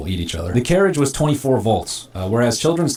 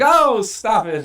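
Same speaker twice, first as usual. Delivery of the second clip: teasing family; 11 semitones higher, much slower than usual. Pretend. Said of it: "Everyone's like".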